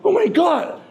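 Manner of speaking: high, laughing oh my God; a disbelieving, laughing cry; exasperated. Retold or imitated. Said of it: "I was like".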